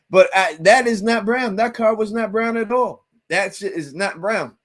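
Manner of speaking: high, loud, exasperated denial; indignant, voice climbing on every not; emphatic insistence. Real, not acted. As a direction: hammer home that it was not brown. Insists the car wasn't brown.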